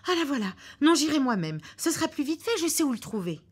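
wife finding the key; hurried excitement. Acted. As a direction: rush to do it herself.

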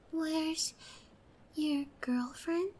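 soft, slow whisper; slow menacing whisper. Acted. Captor whispering about his girlfriend, slow menacing whisper. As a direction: let the word hang darkly. Hushed mention of rival.

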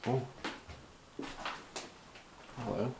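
ordinary talk

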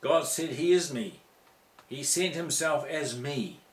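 normal talking voice

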